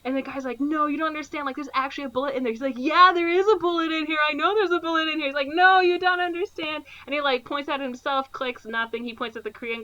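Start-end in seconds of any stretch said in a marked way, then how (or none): 0.6-2.5 higher, hurried pleading; mock panic. Korean guy insisting the bullet is real, panicking. 2.8-5.3 high, loud, exasperated insistence; mock insistence. Ben Stiller answering the Korean guy's warning, insistent. Retold bluffer insisting, not getting it. 5.5-6.9 high, loud desperate plea; mock panic. Korean guy as the gun is waved around, panicking.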